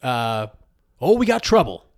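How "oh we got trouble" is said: lightly voiced showman, pitched up; a hint of a 1950s pitchman proclaiming; old-timey radio announcer. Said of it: "like an old-timey, like, radio announcer kind of voice".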